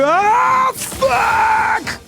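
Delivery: sudden high shocked yell; startled shout. Real, not acted. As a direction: yelp it out in real shock. Startled in game.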